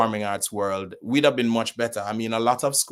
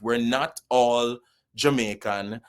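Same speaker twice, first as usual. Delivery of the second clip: usual speaking voice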